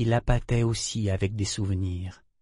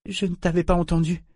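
guilty; 8 semitones higher than usual. A narrator's reading. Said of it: "dit-elle d'un air coupable".

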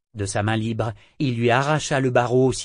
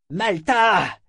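calling out a name, high; shout; 9 semitones higher, 4 dB louder than usual. A narrator's reading. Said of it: "cria-t-elle".

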